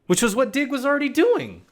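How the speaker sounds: high, incredulous emphasis; high-pitched exasperation. Real, not acted. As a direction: pitch jumps way up, held high. Repeats it in exasperated realization.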